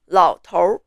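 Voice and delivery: 老头儿 ending in a curled R; Putonghua R sound. Shown as a demonstration. Teacher repeating the Putonghua word for old man, with R ending. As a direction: add the R to the end of 老头儿. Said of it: "that is the R sound".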